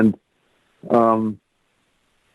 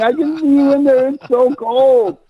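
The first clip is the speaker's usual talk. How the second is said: much higher, half-shouted plea through a door; retold shouting. Stranded driver pleads with woman through window, shouting. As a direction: call out to the woman hiding inside. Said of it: "And I'm shouting".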